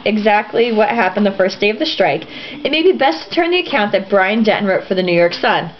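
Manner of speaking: usual speaking voice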